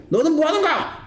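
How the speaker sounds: high-pitched mock scolding; mother's angry yell. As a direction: lightly imitate a mother snapping at her child. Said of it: "소리를 한번 팍 지르고".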